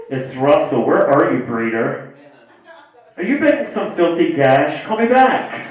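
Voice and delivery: lightly hammed-up cousin on the phone; imitated caller, big on the sign-off. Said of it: "My cousin calls me every week".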